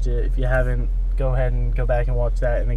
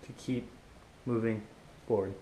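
while brushing teeth; 2 semitones lower, 12 dB quieter than usual. Real, not acted. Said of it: "I'm brushing my teeth".

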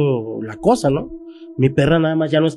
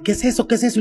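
higher, frightened repeated question; frightened girlfriend; 8 semitones higher, faster than usual. Retold or imitated. Girlfriend asking about the creature, higher frightened voice. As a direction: let the fear push the voice up. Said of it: "Ella me empieza a decir".

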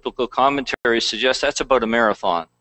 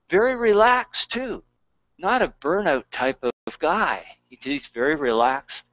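normal talking voice

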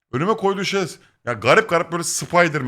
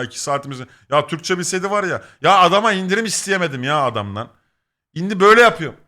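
normal talking voice

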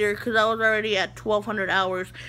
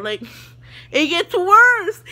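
laughs through it, louder and slow; laughing; 7 semitones higher, 8 dB louder, slower than usual. Real, not acted. Telling the viewers the story, laughing loudly and slowly. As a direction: giggle out the confession. Laughing at her own blunder.

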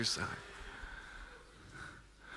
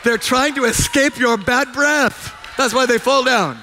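loud, high sermon delivery, driving each question home; fervent preaching; 8 semitones higher, 5 dB louder than usual. Acted. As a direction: preach it at full force to the congregation. Preaching with jokes.

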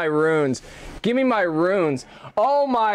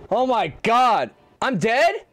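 ordinary talk, nothing marked

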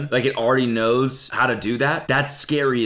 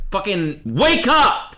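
slow, punched, high and loud; mock yell; 11 semitones higher, 6 dB louder, much slower than usual. Yelling at someone stopping dead on the sidewalk, mock yell. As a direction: bark it at a dawdler on the sidewalk. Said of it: "It's just like".

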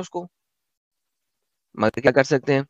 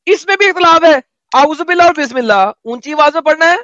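high, raised-voice incredulity; pitched way up, scoffing loudly; mocking exclamation; 9 semitones higher, 4 dB louder than usual. Real, not acted. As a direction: exclaim it high and loud, in mock amazement. Mock amazement after long laugh.

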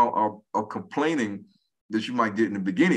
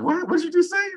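couldn't follow a word, pitched high; baffled disbelief; faster than usual. Retold or imitated. Husband baffled by Jamaican slang, high incredulous question. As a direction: voice shooting way up in confusion.

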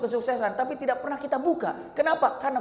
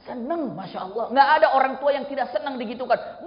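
normal talking voice

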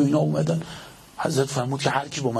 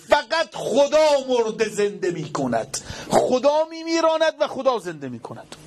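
mocking pious villain; 10 semitones higher than usual. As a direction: lecture him with fake piety. Villain preaching at Ali.

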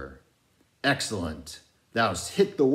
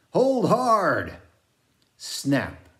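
sudden high shout, much louder; shouted warning; 11 semitones higher, 8 dB louder, much slower than usual. A narrator's reading. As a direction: yell it as the line snaps tight. Old reelman shouts as line strains.

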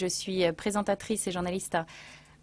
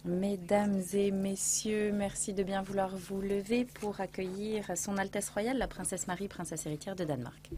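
formal announcer; 7 dB quieter than usual. Acted. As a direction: announce the royal guest to the hall.

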